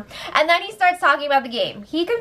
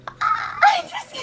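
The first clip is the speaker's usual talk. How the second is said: squealing laugh. Real, not acted. Laughing at drama.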